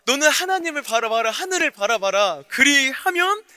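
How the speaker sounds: commanding preaching. Acted. Psalmist loudly commands his own soul to hope. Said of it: "자기 영혼에게 ... 명령하잖아요".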